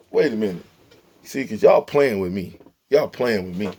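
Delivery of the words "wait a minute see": usual speaking voice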